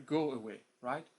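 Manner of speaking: ordinary delivery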